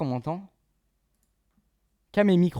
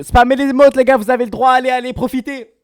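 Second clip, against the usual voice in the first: much louder, higher hype call to chat; hype shout; 11 semitones higher, 17 dB louder than usual. Real, not acted. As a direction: pump up the crowd at full volume. Hypes chat over music.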